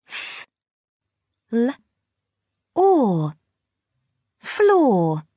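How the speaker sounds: "F. L. Or." said as separate sounds, then blended into "Floor"; sounding out words. Shown as a demonstration. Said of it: "Blend the sounds".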